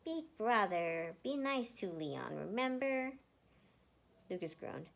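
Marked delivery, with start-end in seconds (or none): 0.0-3.1 put-on slow, louder little boy's plea; pouting child. Emil pouting at his brother to be nice to Leon. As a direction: whine gently at big brother. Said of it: "Emil pouted".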